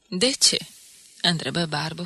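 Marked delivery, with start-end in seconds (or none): 0.1-0.6 louder, higher question in mild irony; uninterested, ironic. A narrator's reading. Barbu answering Borlacu's question, more ironic than curious. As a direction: ask it ironically, not really wanting to know. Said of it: "fără pic de interes, mai mult în ironie".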